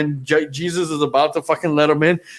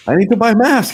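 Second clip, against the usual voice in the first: pitched way up and louder, desperate customer; high pleading voice; 8 semitones higher, 5 dB louder, faster than usual. Retold or imitated. Mask-less guy pleading with a store clerk, high and loud. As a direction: squeak out the plea for a mask.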